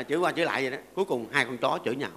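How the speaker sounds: ordinary talk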